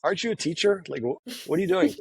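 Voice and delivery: high incredulous retort; mock outrage at the teacher; answering the teacher in high disbelief. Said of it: "So I was like".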